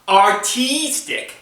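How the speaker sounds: slow, rising "Artistic"; drawn-out artistic. Shown as a demonstration. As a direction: stretch out "Artistic" slowly with a rising tune. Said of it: "E, S, T, I, C".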